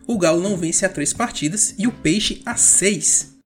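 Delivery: ordinary talk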